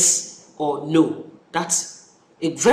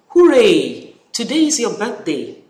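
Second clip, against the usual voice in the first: excited exclamation; 4 semitones higher, 5 dB louder than usual. Shown as a demonstration. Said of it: "look at number one".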